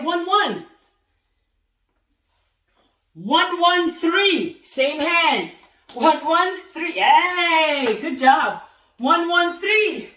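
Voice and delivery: loud, high punch call-outs; shouted counts. Shown as a demonstration. Coach calling punches to boxers, loud counts. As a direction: bark each count out to the class. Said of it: "ready, and let's go".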